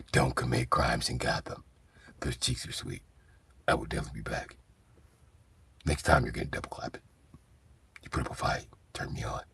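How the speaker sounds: low, soft hushed Batman, half whispered; whispery Batman. Retold or imitated. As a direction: purr it out like a creepy hero. Batman keeps talking to inmates.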